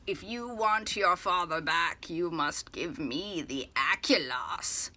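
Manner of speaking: slow, lightly voiced sinister caller; a hint of a menacing phone villain, unhurried; creepy mysterious voice. Villain demands the Aculos from Artemis, slow mildly creepy voice. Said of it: "all creepy and mysterious".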